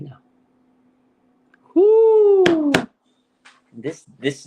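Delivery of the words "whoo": high, loud celebratory whoop; excited whoo. Real, not acted. Fan cheering his quarterback with a loud whoop. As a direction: let out a big fan's whoop. Celebrating Purdy, best since Young.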